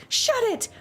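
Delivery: high, sharp yell at the onlookers; snapping shout. Acted. Glaring over her shoulder at the boys, high shout. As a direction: snap at them to shut up.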